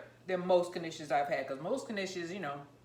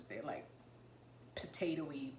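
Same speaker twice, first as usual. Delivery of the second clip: whispering; 4 semitones lower, 13 dB quieter than usual. Real, not acted. Whispered aside about knishes.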